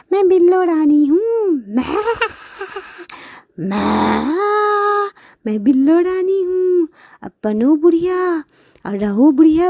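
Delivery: high taunting voice; mock them without mercy; gloating buffalo. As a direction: buffalo Billo taunting the greedy sister.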